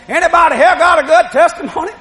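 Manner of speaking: put-on rally the congregation like an old preacher; preacher's call. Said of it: "That's what the preacher said".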